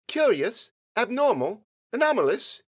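put-on high child's voice listing big words; precocious little girl. A narrator's reading. Girl supplying synonyms to her sister, high and precocious. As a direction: offer each word in a high girl's pitch.

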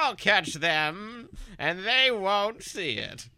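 slow villain. Acted.

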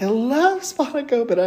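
light gush over her favourite food; mock-excited squeal of love; gushing delight. Student's eyes lit up, retold.

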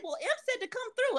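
high, fast, sheepish voice; mock excuse. Driver excusing himself to the brother, high and quick.

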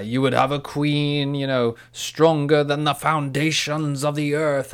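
slow, loud, grand queenly proclamation; Galadriel from the film. Retold or imitated. Podcaster quoting the queen tempted by the ring, slow, loud proclamation. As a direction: imitate a tempted queen, swelling and drawn out. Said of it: "And she says".

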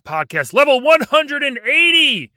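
high loud show greeting; big hype podcast hello; excited intro. Real, not acted. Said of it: "podcast intro greeting".

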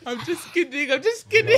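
laughing. Real, not acted. Laughs off the joke.

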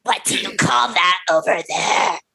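strained creepy-man voice; creepy stranger. Acted. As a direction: put on a creepy guy's voice. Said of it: "So I'll be Indrid".

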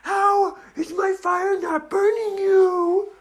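high, loud, drawn-out dragon wail; frustrated girl dragon. Acted. Dragon's fire fails on the kids, high exasperated wail. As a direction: complain slowly in a high dragon voice. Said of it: "and she said".

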